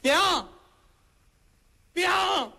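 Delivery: loud, high call across the yard. Acted. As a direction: call for your mother from the yard. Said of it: "迈步站在院里就喊".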